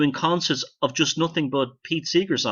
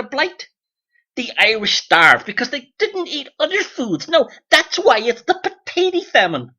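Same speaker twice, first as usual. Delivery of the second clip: much higher, louder, mocking the American take; 10 semitones higher, 7 dB louder than usual. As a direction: lampoon the American version of history. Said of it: "as the Americans call it".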